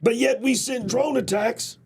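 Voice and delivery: building to an indignant shout; rising outrage. Real, not acted. Outraged at unauthorized drone strikes.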